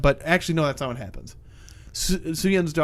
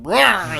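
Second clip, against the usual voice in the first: short mock megalodon roar, higher and louder; monster roar; 5 semitones higher, 6 dB louder than usual. Retelling how the giant shark wakes up, mock roar. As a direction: roar like a waking beast.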